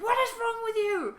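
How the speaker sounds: hammed-up high, loud wail of frustration; mock outburst, half laughing, pleading for approval; bitter exasperation. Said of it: "getting really bitter".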